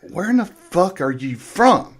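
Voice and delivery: imitating stunned, drawn-out question; incredulous question. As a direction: slowly ask it in mock disbelief. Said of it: "nobody had actually heard that deep of a Southern drawl".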